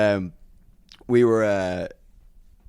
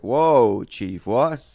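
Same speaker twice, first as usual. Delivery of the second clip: taken aback; 4 semitones higher, 8 dB louder, much slower than usual. Retold or imitated. Reacting to audience accusation.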